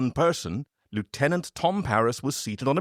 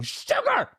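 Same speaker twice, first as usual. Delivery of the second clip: sudden high shouted cry; yelled curse. Retold or imitated. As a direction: yell it like you stubbed your toe. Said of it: "stubbing her toe and yelling".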